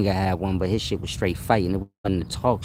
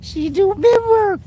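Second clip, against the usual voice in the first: laughing; 5 dB louder than usual. Real, not acted. Laughing squeal.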